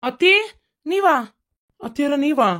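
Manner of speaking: high, startled double take; startled surprise. Acted. Son-in-law finds his mother-in-law at the door, startled. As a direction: blurt it out in high-pitched disbelief.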